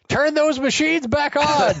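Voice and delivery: high mock voice. Voicing Cobra's callous line.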